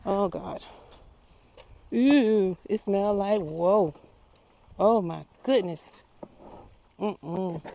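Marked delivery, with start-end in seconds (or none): 1.9-3.9 disgusted. Real, not acted. Reacting to compost smell. 4.8-7.6 disgusted. Real, not acted. Reacting to compost smell.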